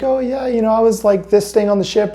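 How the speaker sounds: high, eager friendly voice; friendly stranger. Retold or imitated. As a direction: play the chatty vet.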